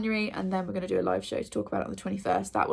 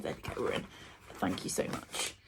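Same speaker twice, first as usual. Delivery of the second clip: whispered thanks; 12 semitones lower, 10 dB quieter than usual. Real, not acted. Parcel finally opens, murmured thanks.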